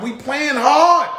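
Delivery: drawn-out excited boast; hyped up. Acted. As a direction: pump up the team proudly. Hyping his team.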